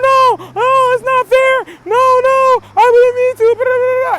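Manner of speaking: high, loud mock-whining child; hammed-up tearful child protest; whining crying kids. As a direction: imitate kids begging and crying. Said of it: "They'll be crying".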